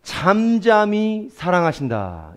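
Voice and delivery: slow, deliberate reading; slow, tender 너를 잠잠히 사랑하신다; slow emphasis. Shown as a demonstration. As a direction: savour each word slowly. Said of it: "제일 중요한 말이".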